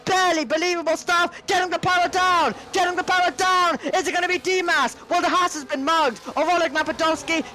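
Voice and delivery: high excited race call; live play-by-play at full pace; motorsport commentator. Acted. Three wide battle call.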